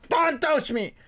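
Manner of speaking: high-pitched mock upset girlfriend; fighting while crying. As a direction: imitate a tearful partner pushing you away. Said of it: "the people that's still fighting while crying".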